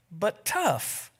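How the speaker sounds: a touch of eager pride; excitedly. A narrator's reading. The Lord proudly defending his creation, a touch excited. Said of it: "said the Lord excitedly".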